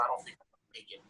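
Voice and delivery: broken, almost voiceless retelling; near tears. Retold or imitated. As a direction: let the voice fade to a near whisper, beaten. Homeless parolee in tears retold.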